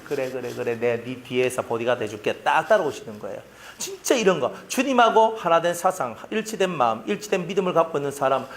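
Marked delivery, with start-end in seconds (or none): 0.0-2.3 soft, quiet reassuring promise; gentle voice of the Lord. Retold or imitated. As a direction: murmur it like a kind guardian behind you. Lord voiced gently following behind.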